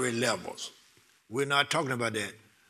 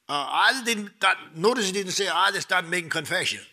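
higher, emphatic sermon push; insistent preaching; 5 semitones higher than usual. Acted. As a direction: drive home the point to the church.

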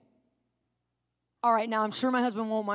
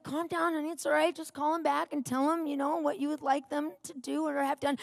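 soft, higher placating voice; acted-out soothing, lightly pitched up; trying to calm; 4 semitones higher, 4 dB quieter than usual. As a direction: gently talk someone down. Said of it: "try to calm him down".

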